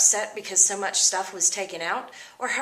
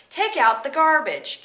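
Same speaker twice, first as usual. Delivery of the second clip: lightly voiced parent, pitched higher; parent giving orders; 6 semitones higher, 3 dB louder than usual. Teacher quoting parents ordering a chore, mildly bossy.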